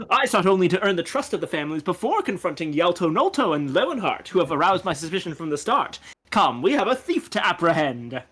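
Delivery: theatrical, self-assured gentleman sleuth; gentleman investigator. Acted. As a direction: play a pompous detective with lively pitch swings.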